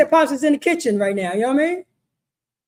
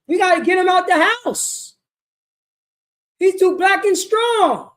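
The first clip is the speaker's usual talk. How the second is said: higher, emphatic complaint; pitched-up mock indignation; mock alarmed plotter; 5 semitones higher, 4 dB louder than usual. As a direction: play a schemer objecting to the man. Acting out plotters against strong man.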